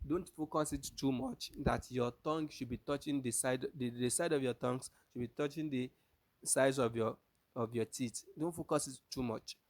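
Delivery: normal talking voice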